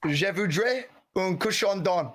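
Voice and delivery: slow French sentence in a light French accent. Shown as a demonstration. Friends asked to hear the French line, slow French. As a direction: say it slowly in careful French. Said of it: "Give us the example. Say it".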